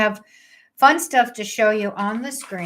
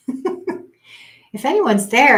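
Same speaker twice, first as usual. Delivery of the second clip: giggling through the words; light laugh in the voice; 7 semitones higher, 7 dB louder, much slower than usual. Real, not acted. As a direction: laugh as you ask. Laughing while asking viewers.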